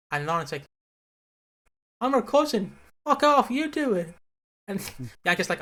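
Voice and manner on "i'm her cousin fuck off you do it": high-pitched acted protest; mock indignant refusal. Lauren refusing to help Bianca at the birth, mock indignation. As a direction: push back sharply, imitating an outraged cousin.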